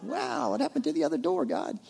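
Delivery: mock bewildered prayer. Mocking a confused believer's prayer.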